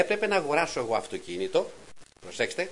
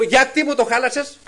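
loud, high reproach to the Lord; complaining to God; 11 semitones higher, 10 dB louder than usual. Retold or imitated. Man complaining to the Lord about his wrecked car, loud. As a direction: demand an answer, loud and high. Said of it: "λέω".

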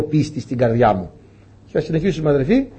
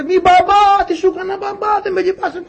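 put-on child's shrill, much higher plea to his father; frightened boy crying out to his dad; shrieking child. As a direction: beg like a terrified boy, voice pitched way up. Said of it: "θα τσίριζε".

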